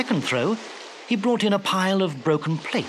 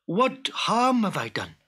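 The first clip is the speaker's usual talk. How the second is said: mild, higher-pitched plea; understated desperate question; pleading cry; 4 semitones higher than usual. Acted. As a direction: protest your innocence to a captor. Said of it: "cried Abdullah".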